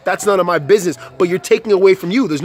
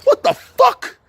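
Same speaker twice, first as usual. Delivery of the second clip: high, louder cry of disbelief; exasperated outburst; 4 dB louder, slower than usual. Real, not acted. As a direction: throw it out in total exasperation.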